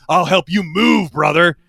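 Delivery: loud, slow mock wrestler boast; wrestler promo voice. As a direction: bellow it like a wrestler hyping a match. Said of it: "He's like".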